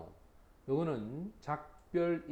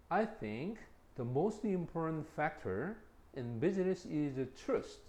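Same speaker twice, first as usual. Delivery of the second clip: slow reading; much slower than usual. Shown as a demonstration. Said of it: "slow example reading".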